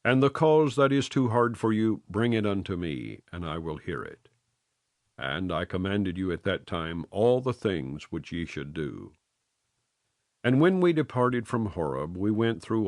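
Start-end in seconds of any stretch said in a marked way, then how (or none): none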